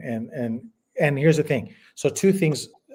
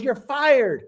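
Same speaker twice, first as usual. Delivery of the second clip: higher, punchy TV-boss "You're fired"; Donald Trump catchphrase; 9 semitones higher than usual. Retold or imitated. Guest imitating the TV boss firing someone. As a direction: fire them like a reality show host. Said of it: "He goes".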